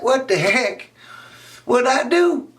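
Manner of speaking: put-on high, baffled kid voice; high and baffled from inside; confused boy. Raymond shut in the locker, high and baffled.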